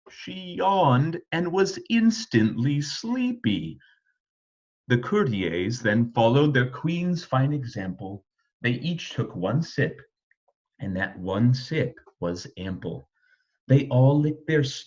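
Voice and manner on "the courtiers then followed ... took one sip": ordinary delivery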